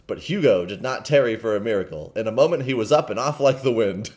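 usual speaking voice

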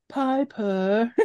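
high-pitched "Piper" with the final r dropped; odd name pronunciation. Retold or imitated. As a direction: say "Piper" as Pie-puh, mimicking the actress. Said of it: "neither one of them say the".

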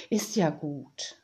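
low, slow reassurance; calm them down, low and unhurried; soothing. A narrator's reading. The woman calms the upset Tiziana, soothing. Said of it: "sagte die Frau beruhigend".

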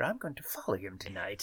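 hushed conspiratorial whisper. Pretend. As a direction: whisper it like a sneaky plan. Conspiratorial whisper about spying.

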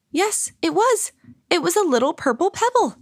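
high-pitched, excited wonder; high, delighted narration; thrilled discovery. A narrator's reading. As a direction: let the joy lift your voice high. Discovering the longed-for pebble.

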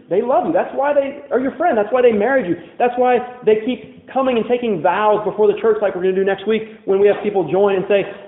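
usual speaking voice

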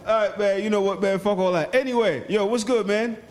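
brash high-energy hello; hyped greeting. Real, not acted. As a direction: hype up the caller.

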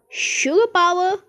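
slow, high, loud boast; proud proclamation. A narrator's reading. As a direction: proclaim it grandly and slowly. Wonka proudly explains the lift.

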